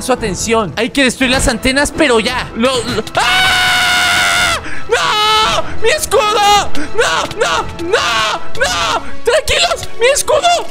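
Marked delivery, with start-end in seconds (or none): 3.2-4.6 drawn-out scream. Real, not acted. Loses shield in fight. 4.9-10.7 panicked yelling. Real, not acted. Loses shield, crying out.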